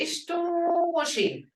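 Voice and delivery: Ishtoshi high and slow with a fall. Shown as a demonstration. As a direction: draw out Ishtoshi high, then drop. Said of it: "but if I say".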